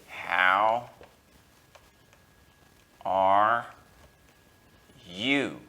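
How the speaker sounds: "How ... are ... you?" with long pauses between words; slow question read. Shown as a demonstration. Teacher spelling out a question slowly for students. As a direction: space out each word, "How", "are", "you". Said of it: "Let me say".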